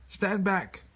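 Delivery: high loud roared command. A narrator's reading. Chief ordering the girl back, loud roar. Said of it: "roared the chief".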